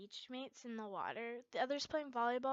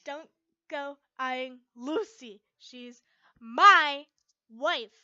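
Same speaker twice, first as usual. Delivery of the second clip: loud, slow jealous threat; threatening; 2 semitones higher, 19 dB louder, much slower than usual. A narrator's reading. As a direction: warn him off, getting louder. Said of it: "I threatened".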